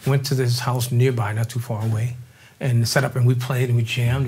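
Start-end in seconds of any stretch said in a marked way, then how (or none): none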